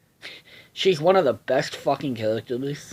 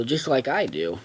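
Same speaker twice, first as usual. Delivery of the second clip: usual speaking voice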